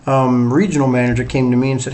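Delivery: usual speaking voice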